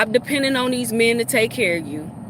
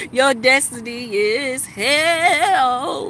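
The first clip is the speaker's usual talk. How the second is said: slow taunting; 3 semitones higher, much slower than usual. Real, not acted. Slow sing-song repeat.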